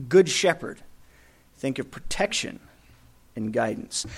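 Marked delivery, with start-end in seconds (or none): none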